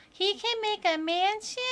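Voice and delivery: put-on small child asking, lighter voice; little kid voice. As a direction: play a curious little kid wide-eyed. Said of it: "the little kid voice that I'm doing".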